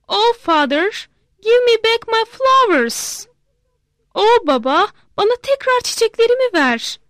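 a daughter begging her father to undo it; pleading little girl. Acted. Girl begs father back.

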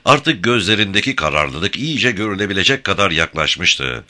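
plain reading voice

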